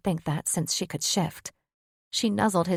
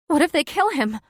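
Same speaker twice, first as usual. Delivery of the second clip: panicked; 9 semitones higher, 7 dB louder than usual. Acted. Panicked mother.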